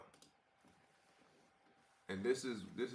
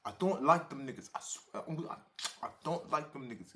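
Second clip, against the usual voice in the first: mocking throaty imitation; 3 semitones higher than usual. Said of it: "You talking dead out the back of your throat".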